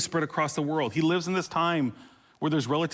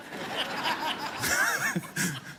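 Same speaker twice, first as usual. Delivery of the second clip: amused laugh; 10 semitones higher, 5 dB quieter than usual. Real, not acted. Laughs at his own voice.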